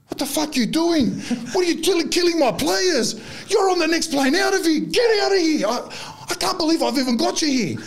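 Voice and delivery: high-pitched, hammed-up screaming rant; Eurocalo furious. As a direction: scream like an enraged coach storming the pitch. Retells the coach screaming on the field.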